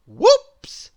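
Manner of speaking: high, loud squeal of whoops; squeaky whoops. A narrator's reading. Narrator squeals when eggs drop. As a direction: squeal it like a sudden slip. Sound-effect exclamation after accident.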